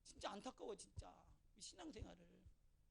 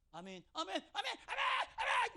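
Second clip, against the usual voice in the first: amens rising higher and louder; building amen; 6 semitones higher, 8 dB louder than usual. Shown as a demonstration. Preacher showing how excited amens should grow. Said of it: "막 이래야지".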